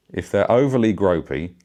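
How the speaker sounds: ordinary talk, nothing marked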